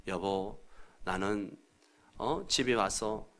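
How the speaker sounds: soft, low, gentle husband; 차분한 아이 메시지. Acted. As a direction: tell the wife your feelings softly, with pauses. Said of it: "어떤 아이 메시지가 어떤 거예요". (나직하고 부드럽게 말하는 남편)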